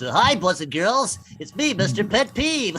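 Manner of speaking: slow, hammed-up children's presenter; cheesy kids' show introduction; bad kid show host. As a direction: greet the kids like a cheesy TV host. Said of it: "Sounds like a bad kid show host".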